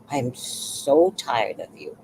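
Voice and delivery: slow, exasperated repeat, not shouted; retold blow-up. Retelling blowing up at the neighbor, slow exasperation. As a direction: drag it out, fed up. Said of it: "So then I blew up".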